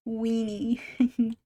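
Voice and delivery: giggled "Weenie"; a silly giggle on "Weenie"; giggling. Real, not acted. Giggling again.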